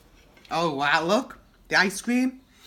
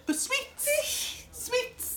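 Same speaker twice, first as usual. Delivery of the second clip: laughing whisper; 4 dB quieter, much slower than usual. Real, not acted.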